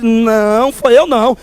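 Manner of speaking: ordinary delivery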